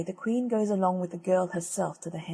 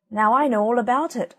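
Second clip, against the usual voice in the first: cocky blackbird; 4 semitones higher, 8 dB louder than usual. Acted. Blackbird boasts before flying off.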